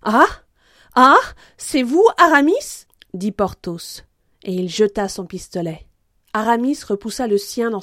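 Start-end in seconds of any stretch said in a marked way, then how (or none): 0.0-2.9 high, loud, rising question; astonished recognition. Acted. Porthos finding his friend opposite, loud astonishment. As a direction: exclaim in surprise at a friend.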